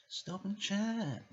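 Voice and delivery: high, drawn-out angel voice; little angel. Acted. Angel on the shoulder urging him, high angelic coaxing. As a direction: sing it out slowly, very high. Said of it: "little angel on my left shoulder said".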